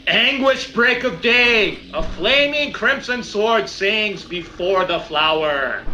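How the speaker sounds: slow, grandly declaimed verse; dramatic haiku recital. Acted. Warrior drops a haiku to get the beast's attention, declaiming. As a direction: declaim a poem to taunt a monster. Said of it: "I drop some haiku".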